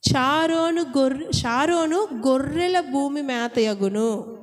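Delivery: slow, solemn Bible reading voice; scripture reading. Acted. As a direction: read the verse out slowly and solemnly. Reads scripture. (నెమ్మదిగా గంభీరంగా Bible పఠనం)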